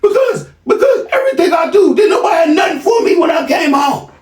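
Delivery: high shouted mimicry; crying son shouting. Crying son blaming his father, high shout. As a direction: yell it high like a sobbing son. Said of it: "Why you crying?".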